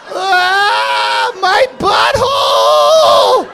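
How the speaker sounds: high, loud, slow drawn-out mock scream; mock pained scream. Comic acting out the girl hit by the cork, loud hammed-up scream. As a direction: wail it like a hammed-up injured girl. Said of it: "she was like".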